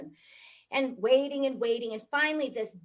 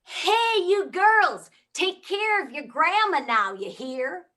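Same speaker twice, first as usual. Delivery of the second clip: much higher and much louder, a loud country holler; hillbilly yell; 8 semitones higher, 10 dB louder, slower than usual. Retold or imitated.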